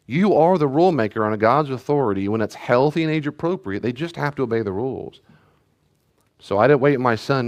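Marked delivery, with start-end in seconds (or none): none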